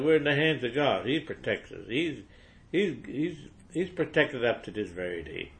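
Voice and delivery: ordinary talk, nothing marked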